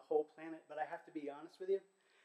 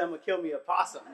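louder, fast, through laughter; laughing quote; 2 semitones higher, 13 dB louder, faster than usual. Retold or imitated. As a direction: relish it, chuckling as you say it. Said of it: "He said".

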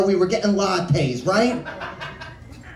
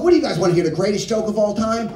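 normal talking voice

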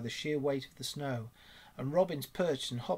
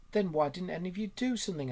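higher-pitched, mildly acted reproach; indignant challenge; 5 semitones higher, 3 dB louder than usual. A narrator's reading. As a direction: demand an explanation from them. Otter challenges the rabbit.

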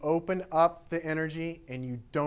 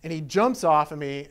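plain reading voice

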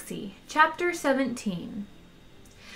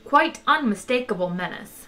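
plain reading voice

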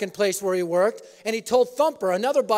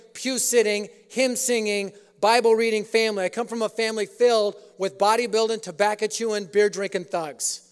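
ordinary delivery

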